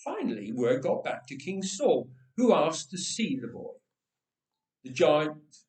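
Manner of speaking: ordinary delivery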